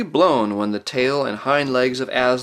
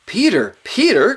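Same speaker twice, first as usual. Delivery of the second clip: high, loud calling out; eager boyish shout of a name; excited cry; 11 semitones higher, 6 dB louder than usual. A narrator's reading. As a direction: call urgently to your brother. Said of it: "cried Edmund".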